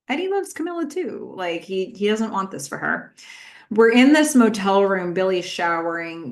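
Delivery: normal talking voice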